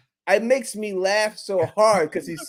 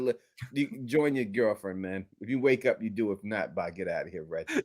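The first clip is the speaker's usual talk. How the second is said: much lower and softer, fading away; getting lower and softer to the end; sleepy dismissal; 11 semitones lower, 8 dB quieter than usual. Pretend. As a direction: murmur him off to sleep. Imagined put-down of Reggie.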